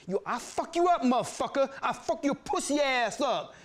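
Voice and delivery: high, angry threatening yell; bully squaring up to fight; threat. Retold or imitated. David threatening the narrator, high angry yell. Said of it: "David threatens".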